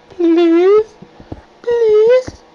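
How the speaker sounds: high, loud whining plea; whiny begging. Real, not acted. Pleading with the mine for ores, high whiny begging. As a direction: beg like it's hopeless.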